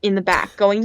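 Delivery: ordinary delivery, nothing marked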